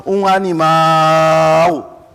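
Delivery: last vowel held very long on one level note; stretched word. Shown as a demonstration. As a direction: draw out the end of "animal" slowly. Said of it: "estica a palavra".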